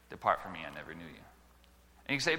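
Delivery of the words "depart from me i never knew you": solemn judgment. Acted. God's judgment quoted.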